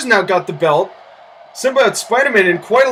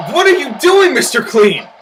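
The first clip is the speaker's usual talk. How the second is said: exasperated disbelief; 6 semitones higher than usual. Real, not acted. Exasperated at Mister Clean.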